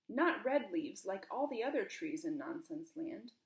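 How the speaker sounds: ordinary delivery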